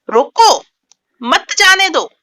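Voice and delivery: loud रोको, break, then high emphatic मत जाने दो; pause after रोको. Shown as a demonstration. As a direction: command रोको, stop, then मत जाने दो forcefully. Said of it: "के बाद विराम चिन्ह लगाया गया है". (रोको पर ज़ोर देकर विराम)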